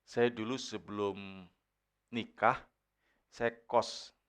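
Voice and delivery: ordinary talk, nothing marked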